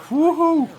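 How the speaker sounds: high, loud whooping cheer; woohoo cheer. Real, not acted. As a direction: let out a big victory whoop. Celebrating strikeout.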